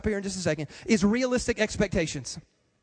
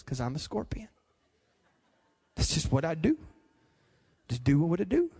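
much lower, softer, matter-of-fact scorpion; cold scorpion; 7 semitones lower, 3 dB quieter than usual. Retold or imitated. Scorpion telling the dying frog its nature, low and cold. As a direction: drop low and shrug it off.

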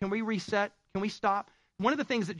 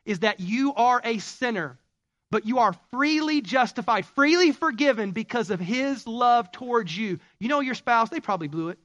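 soaring pulpit cry about grace; preaching at full force; 5 semitones higher, 5 dB louder than usual. Acted. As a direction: lift the congregation with it.

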